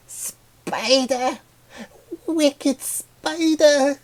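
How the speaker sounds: very high, drawn-out, loud shriek of horror; hysterical cry. Acted. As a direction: scream it as if a spider were on you. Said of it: "the third flower cried hysterically".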